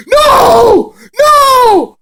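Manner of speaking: very high, loud, drawn-out wail; hammed-up shriek of protest; mock horrified scream. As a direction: scream it in falsetto despair. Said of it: "They were like".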